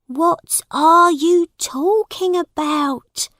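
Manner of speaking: high, slow, baffled question; worried mother. A narrator's reading. Mother questioning her confused son, worried. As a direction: ask it loudly in motherly alarm.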